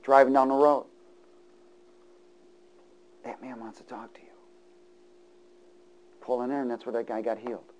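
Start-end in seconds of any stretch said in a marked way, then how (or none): 3.2-4.3 whispered inner prompting; just a breath of a voice. Retold or imitated. Preacher voicing the Spirit urging him, whispered.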